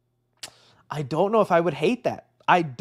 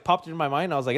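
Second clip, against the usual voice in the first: ordinary talk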